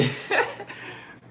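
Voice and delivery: high, breathy burst of laughter; high giggle. Real, not acted. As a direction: giggle at the name. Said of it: "I have to laugh".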